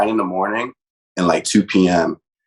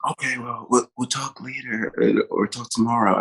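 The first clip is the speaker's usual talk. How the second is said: much softer, hushed, as if hiding under covers; 6 dB quieter than usual. Retold or imitated. Friend imitating a girlfriend hiding a late call, hushed. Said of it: "she sounded like she was trying to hide".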